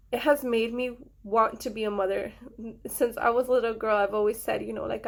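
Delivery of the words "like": ordinary talk, nothing marked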